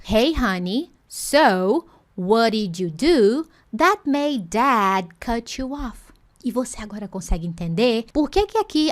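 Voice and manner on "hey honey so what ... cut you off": every word of "Hey, honey, so what did you do that made dad cut you off?" stretched out; careful slow reading of the dialogue line; slow full sentence. Shown as a demonstration. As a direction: read the line slowly, word by word.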